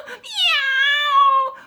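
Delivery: long, high, loud yowl; screeching cat meow. A narrator's reading. Cat screeches at the squeaky bed, loud yowl. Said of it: "the cat".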